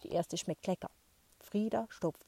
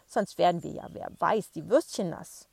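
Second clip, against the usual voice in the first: grandma voice, louder; 8 dB louder than usual. Acted. Grandma continues.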